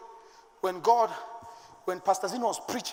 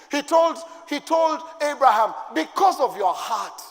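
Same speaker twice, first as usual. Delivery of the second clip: loud, high preaching at full force; booming pulpit delivery; fiery preaching; 6 semitones higher, 10 dB louder than usual. Real, not acted. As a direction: preach it hard to the church.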